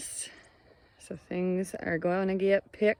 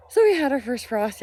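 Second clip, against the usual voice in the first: out of breath; 6 semitones higher, 4 dB louder than usual. Real, not acted. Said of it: "I'm out of breath".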